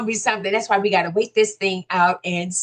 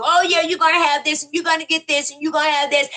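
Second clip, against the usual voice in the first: fast, higher, glib promising; mocking a politician's empty campaign promises; 6 semitones higher, faster than usual. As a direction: rattle off promises like a slick politician. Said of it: "they tell you what they gonna do".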